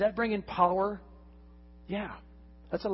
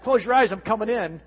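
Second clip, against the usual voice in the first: put-on higher, louder childish call; little kid voice; 5 semitones higher, 7 dB louder than usual. Little kid entering a bathroom.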